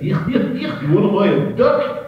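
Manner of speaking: high, silly little-guy voice; Joe Penner. Retold or imitated. Joe Penner's catchphrase in a high goofy voice. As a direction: chirp it like a goofy comic. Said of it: "That's a little guy that said".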